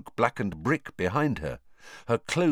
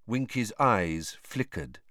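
ordinary delivery

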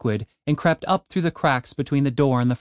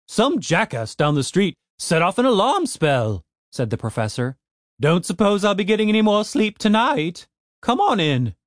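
grumpy gnome professor; 6 semitones higher than usual. Acted.

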